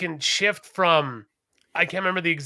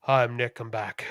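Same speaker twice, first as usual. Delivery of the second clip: deadpan Nick intro; 7 semitones lower than usual. Retold or imitated. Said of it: "sits there again and goes".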